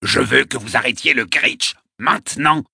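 put-on high-pitched, insistent old man's squawk; yelping. A narrator's reading. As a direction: yelp the demand in a high, impatient voice. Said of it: "glapit-il".

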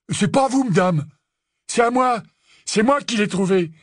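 high, pushed-up protesting voice; defiant protest. Acted. Snatcher refuses to give up his find, defiant. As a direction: insist on your claim, loudly defiant.

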